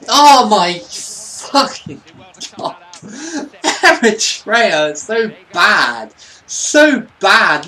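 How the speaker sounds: loud, high, drawn-out wail of frustration; real fed-up outburst, slow and loud; exasperated despair. Real, not acted. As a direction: wail about how useless the team is. Ranting after losing the match.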